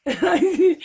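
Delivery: breaks into high laughter mid-sentence; can't stop laughing at the memory. Real, not acted. Laughing while retelling the story.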